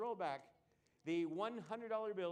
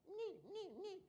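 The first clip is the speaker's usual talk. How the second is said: high squeaky Knights who say Ni; Monty Python knights; 10 semitones higher, 7 dB quieter, slower than usual. Retold or imitated. Magician quoting Monty Python to the crowd, a high squeaky knight cry. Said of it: "Monty Python for you".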